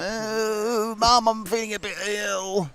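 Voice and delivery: whiny, feeling-ill calf voice; sick calf voice. Pretend. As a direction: moan it like a poorly little calf. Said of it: "Sounds like a slightly unwell calf".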